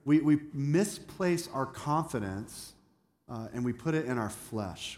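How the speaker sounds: normal talking voice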